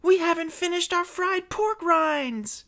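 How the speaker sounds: very high indignant cry; outraged shout. Acted. Zeb protesting an interrupted meal, shouting high. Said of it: "shouted Zeb from the Group D cage".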